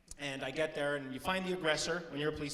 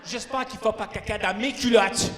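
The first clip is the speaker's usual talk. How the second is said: mock scolding; 6 semitones higher, 10 dB louder than usual. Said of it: "I'm all".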